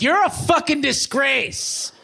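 high, slow sneering stranger voice; mock insult. Stranger scolds the stoned fake fireman, high sneer.

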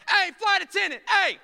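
mock impatient shout for the attendant; calling out. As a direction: flag down the attendant.

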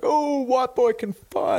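hyped ringside cheer. Retold or imitated. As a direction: whoop it out like an amazed fan. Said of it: "Big Show gets down there and he's like".

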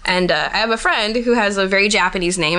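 ordinary talk, nothing marked

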